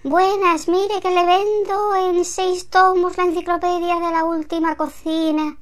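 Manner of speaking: voz de vendedor, más aguda y animada; vendedor insistente. Acted. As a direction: suelta el discurso de venta con ganas. Vendedor de enciclopedias en la puerta. (vendedor a domicilio colocando su enciclopedia, más agudo)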